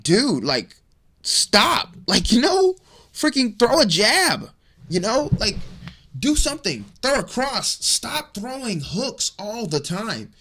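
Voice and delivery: high-pitched, exasperated plea to a fighter; exasperated urging. Acted. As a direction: urge him on in frustration, pitched well up. Exasperated advice addressed to the fighter.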